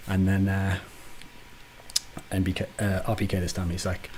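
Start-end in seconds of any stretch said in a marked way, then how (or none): none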